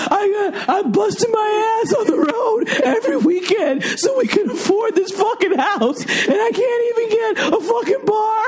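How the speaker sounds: high wailing sobbed rant; hammed-up fake crying shout; mock crying husband. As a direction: ham it up like a blubbering breadwinner. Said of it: "let's do a little role playing".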